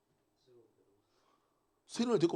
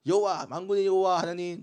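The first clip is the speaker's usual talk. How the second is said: praising Israelites; 6 semitones higher, 6 dB louder than usual. Acted. Israel returning, praising God.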